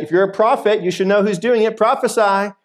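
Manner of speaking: imitating mocking guards. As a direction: jeer at a prisoner to prove himself. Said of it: "just mocking, degrading him".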